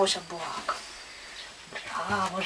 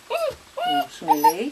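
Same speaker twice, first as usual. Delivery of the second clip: squeaky encouragement; 10 dB louder, much slower than usual. Real, not acted. Urging the dog on.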